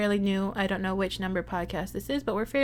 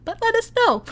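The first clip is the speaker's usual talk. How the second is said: much higher and louder, jokey; sudden high, loud sing-out; mock-sensitive plea shooting up high; playful squeal; 13 semitones higher, 8 dB louder than usual. Jokes they are sensitive, cry a lot.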